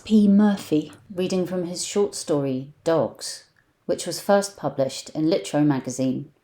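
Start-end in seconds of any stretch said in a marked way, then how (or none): none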